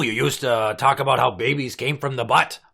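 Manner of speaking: ordinary talk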